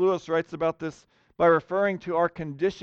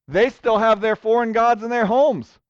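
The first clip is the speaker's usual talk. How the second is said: ordinary talk, nothing marked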